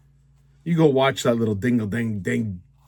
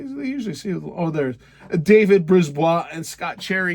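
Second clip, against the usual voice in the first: ordinary delivery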